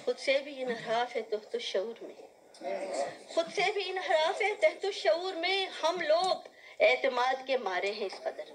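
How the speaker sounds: measured mushaira verse, lifting on the repeats; poetry recitation. Acted. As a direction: declaim the couplet to the audience. Recites ghazal couplets.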